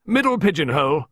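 high, loud order; barked. A narrator's reading. Visitor directing man to the notepaper, barked. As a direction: bark it out as a direction. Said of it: "Miss Peake barked".

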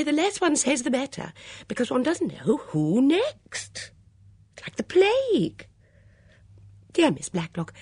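high, swooping, fluttery chatter; parody of herself. A narrator's reading. Missus Swettenham chattering with great effort, a self-parody. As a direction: force bright small talk that keeps faltering. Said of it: "resulted in a kind of parody of herself".

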